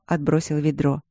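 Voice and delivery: ordinary delivery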